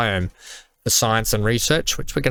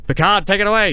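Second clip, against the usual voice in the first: high loud hype shout; excited call; 8 semitones higher, 4 dB louder, faster than usual. Acted. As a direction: call it out like a launch. Launch countdown hype.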